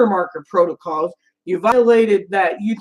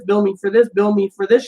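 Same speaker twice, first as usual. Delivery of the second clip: usual speaking voice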